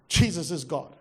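ordinary talk, nothing marked

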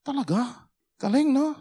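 Tagalog, said high and surprised; Tagalog chatter. Retold or imitated. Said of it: "start speaking Tagalog".

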